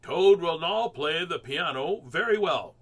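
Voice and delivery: slow, loud showman announcement; strange announcer voice. A narrator's reading. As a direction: present the next act grandly. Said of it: "said a strange voice".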